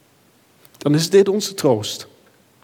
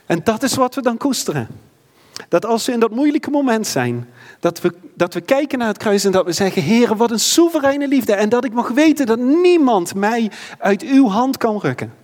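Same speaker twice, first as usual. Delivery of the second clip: higher, impassioned preacher building to a peak; fervent preaching; 7 semitones higher than usual. Acted. Preacher urges the church to trust God's hand, fervent and high. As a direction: lift the congregation with rising fervour.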